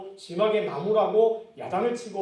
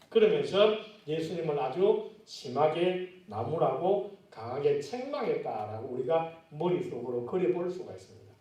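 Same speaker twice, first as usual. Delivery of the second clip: usual speaking voice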